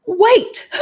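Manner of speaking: high, sudden "Wait" in mock alarm; playfully stopping someone short; mock startled wait. Telling letter writers to stop. Reacting to viewers' overengagement.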